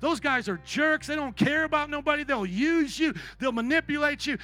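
put-on shouting bitter young minister; angry rant. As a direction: spit out the venom. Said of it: "I say".

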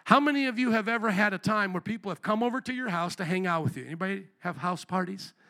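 ordinary talk

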